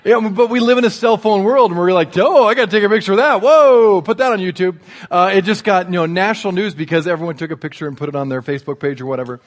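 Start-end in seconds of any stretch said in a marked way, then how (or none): 2.2-4.8 loud, quickened imitation of a gawker; mock excited onlooker. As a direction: play an eager bystander grabbing a picture. Mimics excited onlookers filming the mishap.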